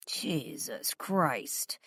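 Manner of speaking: slow exasperated sigh. A narrator's reading. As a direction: breathe it out wearily. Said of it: "he sighed".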